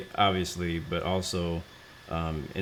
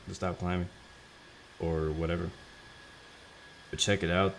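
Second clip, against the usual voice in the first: ordinary delivery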